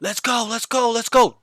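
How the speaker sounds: high, loud excited team shout; urgent rallying call. Retold or imitated. Coach re-enacting student's mid-round call, urgent and excited. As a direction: imitate a caller rallying the team.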